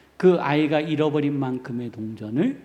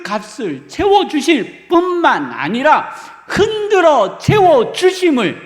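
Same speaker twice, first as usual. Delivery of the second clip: louder, higher preaching in full conviction; fervent preaching; 6 semitones higher, 7 dB louder than usual. Acted. Pastor assures the congregation God repays, fervent preaching. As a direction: proclaim it with rising fire.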